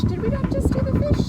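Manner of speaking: playfully surprised question; high, loud disbelieving question. Real, not acted. Teacher surprised by child's answer.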